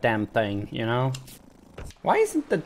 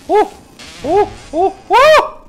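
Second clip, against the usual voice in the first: short, loud, high "oh" cries; startled yelps; 10 dB louder than usual. Real, not acted. Player under attack by mosquitoes, startled yelps. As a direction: yelp repeatedly, the last one biggest.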